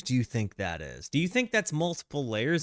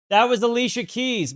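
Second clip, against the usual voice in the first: much higher and louder, caught out by his own mistake; sudden realization; 10 semitones higher, 6 dB louder than usual. Real, not acted. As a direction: exclaim as you realize you were wrong. Realizes he confused two singers.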